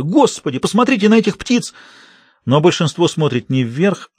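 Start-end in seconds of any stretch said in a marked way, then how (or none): 0.0-1.7 high, alarmed call; shouting. A narrator's reading. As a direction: point out the birds in panic. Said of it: "кричит кто-то".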